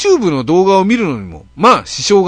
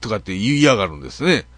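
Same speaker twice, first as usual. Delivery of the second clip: ordinary delivery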